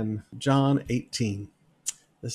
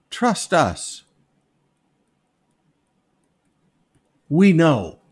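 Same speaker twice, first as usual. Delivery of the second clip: smug, a little louder on "We"; mock smug; 2 semitones higher, 5 dB louder, much slower than usual. Preacher mocking the accusers' empty claim, smug. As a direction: sell it like a con man, lightly.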